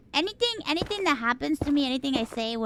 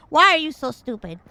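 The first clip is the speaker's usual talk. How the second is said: loud mock scolding; raised-voice mom impression; imitating angry mom; 3 semitones higher, 8 dB louder than usual. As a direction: scold like an appalled mother. Imitating mom catching her crying.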